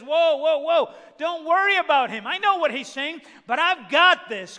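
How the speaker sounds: high, loud, emphatic interruption; emphatic God's voice. Acted. God answering praying Hezekiah through Isaiah, loud and emphatic. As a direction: halt the worry with booming assurance.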